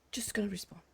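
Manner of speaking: whispered under her breath; whispering. Real, not acted.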